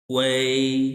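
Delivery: वे with a light ऊ gliding straight into ए; don't break ऊ and ए in वे; quick glide demo. Shown as a demonstration. Teacher models वे as one quick glide. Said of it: "ऊ के बाद फौरन ए".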